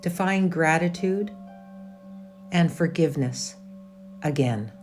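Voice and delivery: calm, measured meditation voice with long pauses; guided meditation. Acted. Yoga teacher leading a resting class through a meditation, calm and measured. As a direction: lead them inward in short, unhurried, evenly pitched phrases.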